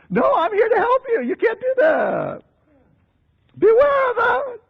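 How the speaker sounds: high-pitched, pleading caution; acted-out alarmed adviser; urgent warning.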